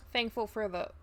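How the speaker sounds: ordinary talk, nothing marked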